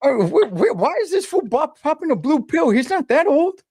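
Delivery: high-pitched disbelief; incredulous. Real, not acted. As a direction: squeak it out in mock shock. Mock outrage over blue pill.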